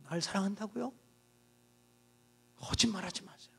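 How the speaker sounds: bitter hushed denial; muttered retort. Acted.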